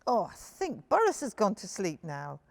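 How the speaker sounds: ordinary delivery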